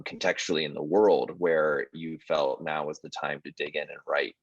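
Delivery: normal talking voice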